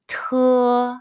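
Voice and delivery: "te" drawn out with a strong puff of air; aspirated t. Shown as a demonstration. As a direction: say "te" with a breathy burst on the t. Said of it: "push a strong puff of breath".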